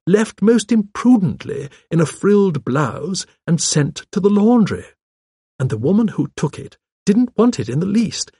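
genteel storyteller, stressing key words; old lady gossip. Acted. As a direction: recount village scandal with relish.

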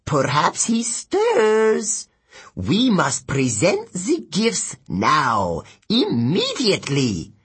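slow, urgent demon voice; warning demon. Acted. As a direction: urge your fellow demon on with drawn-out words. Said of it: "the other demon warned".

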